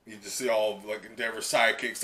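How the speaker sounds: ordinary talk, nothing marked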